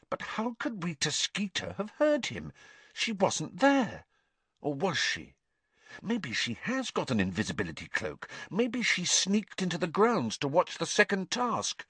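thinking aloud, half to herself; puzzled Hermione. A narrator's reading. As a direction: Hermione wondering, soft and high.

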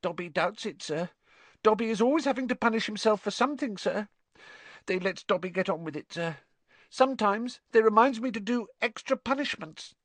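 high, fast squeaky elf; resigned house elf. Acted. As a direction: rattle it off meekly in a high voice.

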